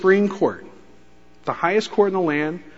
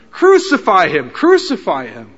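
loud repeated chant of crucify him; crowd shouting; 2 semitones higher, 8 dB louder than usual. Retold or imitated. Preacher voicing the mob demanding Jesus die, loud chant.

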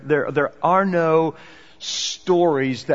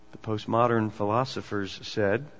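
usual speaking voice

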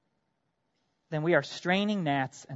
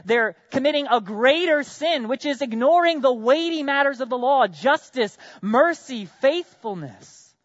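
impassioned preaching; 7 semitones higher, 5 dB louder than usual. Acted. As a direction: drive the list home from the pulpit. Preaching with rising force.

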